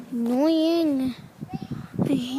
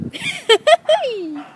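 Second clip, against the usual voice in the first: high-pitched burst of giggling; giggle; 11 semitones higher, 3 dB louder than usual. Real, not acted. Chatting about visiting friends, high giggle. Laughs during the walk video.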